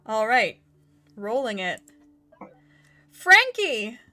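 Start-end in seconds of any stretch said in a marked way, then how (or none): 3.1-4.0 loud, joyful call of a name; excited shout. Real, not acted. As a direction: announce the winner with delight. Announcing the giveaway winner.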